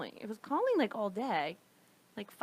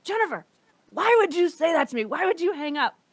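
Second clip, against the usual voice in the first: upset woman; 5 semitones higher, 7 dB louder than usual. Retold or imitated. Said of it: "She was pissed".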